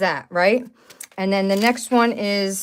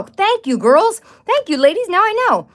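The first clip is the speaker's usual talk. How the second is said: excited thanks; 10 semitones higher, 4 dB louder than usual. Real, not acted. Delighted thanks to chat viewers.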